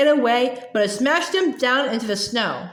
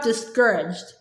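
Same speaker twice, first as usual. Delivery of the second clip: plain reading voice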